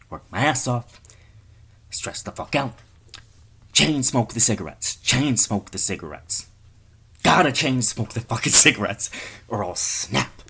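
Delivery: loud, agitated, building to a snap; acted stressed-out rant. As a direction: chant it like a smoker about to crack. Stressed smoker acted at breaking point.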